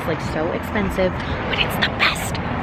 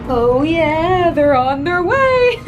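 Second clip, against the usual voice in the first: high and louder, slow through laughter; laughing; 11 semitones higher, 4 dB louder, much slower than usual. Real, not acted. Laughing at the mess.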